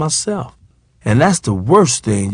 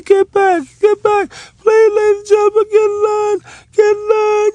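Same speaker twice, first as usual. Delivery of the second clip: squeaky, very high little man pleading for order; little man's high voice. Retold or imitated. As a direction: pipe it up high and tiny like a little bald man. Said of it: "a very low-key voice".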